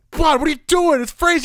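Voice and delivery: high, loud shocked protest; re-enacted shriek of cold shock; startled yelp. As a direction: yelp as if icy hands hit you. Dad reacting to icy hands.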